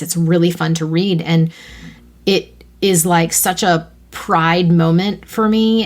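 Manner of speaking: normal talking voice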